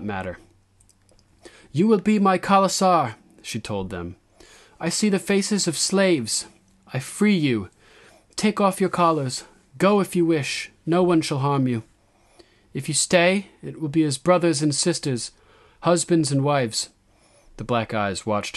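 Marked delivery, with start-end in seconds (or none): 1.7-3.2 high, loud queenly address; raised, ringing declaration; young queen's proclamation. A narrator's reading. 4.8-16.9 high, loud address to her people; raised, declaiming queen; young queen's proclamation. A narrator's reading.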